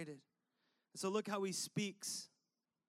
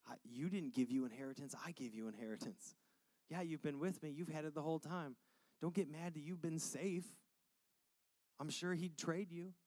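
lower, softer reassurance; father reassuring his jealous son, low and soft; gentle father; 4 semitones lower, 6 dB quieter than usual. Retold or imitated. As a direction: soothe him like a patient dad. Dad reassures older son.

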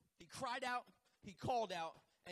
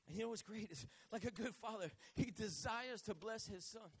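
normal talking voice

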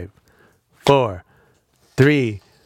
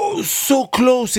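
gasp, then high slow so close; thrilled near miss; excited gasp; slower than usual. Acted. Guess nearly right.

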